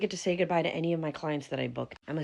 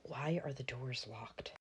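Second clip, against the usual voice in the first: whisper; 12 dB quieter, slower than usual. Acted. Locked out, knocking at door.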